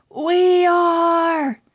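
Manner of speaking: high, loud crowd cheer; crowd shout. Acted. Kids shouting back at the bookstore lady, high and loud. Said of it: "Everybody screamed at the top of their lungs".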